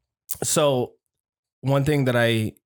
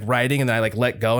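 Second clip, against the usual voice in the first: normal talking voice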